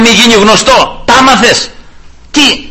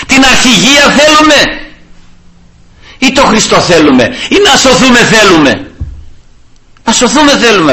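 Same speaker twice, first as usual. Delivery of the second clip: usual speaking voice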